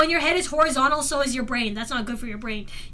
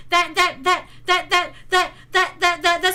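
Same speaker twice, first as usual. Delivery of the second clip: stuck stuttering on one word; rapid repeated-word stutter; crazy stutter. Real, not acted. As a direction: stammer it over and over like a skipping record. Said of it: "that was a crazy stutter".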